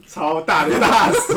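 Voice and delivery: loud, drawn-out words through laughter; real laugh breaking into the words. Real, not acted. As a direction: crack up while saying it, slow and loud. Cracks up describing the huge lock.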